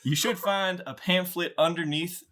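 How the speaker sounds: high-pitched, scripted airline announcer; put-on flight attendant voice; pre-flight announcer. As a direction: read absurd advice as official cabin procedure. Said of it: "you get a pre-flight announcement".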